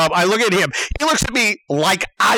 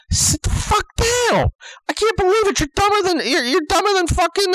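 high-pitched, exasperated telling-off; retold angry scolding; exasperated outburst; 12 semitones higher, faster than usual. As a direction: rant in a high, incredulous voice. Acted outburst at another passenger.

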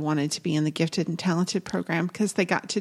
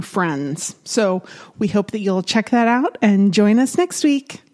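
upbeat host outro read; podcast outro; 5 dB louder than usual. Acted. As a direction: sign off like a radio host.

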